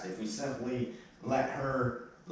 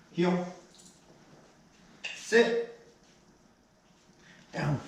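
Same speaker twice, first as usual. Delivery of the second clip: sharp commands; 9 semitones higher, much slower than usual. Real, not acted. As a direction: call each command out firmly. Trainer commanding dog.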